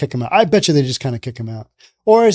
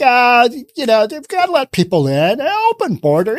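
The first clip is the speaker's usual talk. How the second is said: high mocking voice of a careless official; mock carefree liberal; 7 semitones higher than usual. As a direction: sneer it out like a dismissive politician. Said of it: "They're like".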